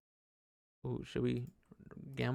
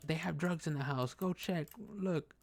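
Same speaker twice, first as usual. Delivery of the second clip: intruder lady; 6 semitones higher, 4 dB quieter than usual. Retold or imitated. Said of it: "the lady was like".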